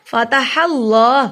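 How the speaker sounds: "فتح اللہ" slowly, thick l and long aa; heavy Allah. Shown as a demonstration. Teacher showing the heavy name, "فتح اللہ" drawn out thickly. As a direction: make "اللہ" full and heavy, held a beat. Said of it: "اس لیے موٹا پڑھیں گے".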